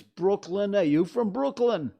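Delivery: loud, high-pitched Brooklyn accent. Shown as a demonstration. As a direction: call it out like a Brooklyn local. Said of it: "fifty New York accents".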